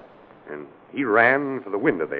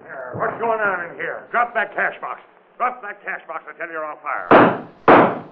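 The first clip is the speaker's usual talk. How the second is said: higher, forceful command to drop it; commanding threat; 7 semitones higher, slower than usual. Acted. Police chief catching the robber, barking commands. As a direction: order the robber to drop the box or be shot.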